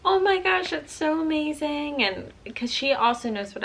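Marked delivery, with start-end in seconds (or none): 0.0-2.0 high, squealing thrilled mom; imitating mom's excitement. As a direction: gush like a delighted mom hearing big news. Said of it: "she was so excited and was like".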